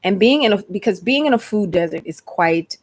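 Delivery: usual speaking voice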